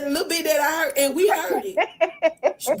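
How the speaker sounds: high voice, real laughs breaking in; talking through laughter. Real, not acted. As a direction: struggle to get the words out for laughing. Repeats the quote while laughing.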